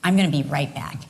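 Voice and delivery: lightly voiced husband cutting her off; imitating husband. Wife imitating husband's reaction.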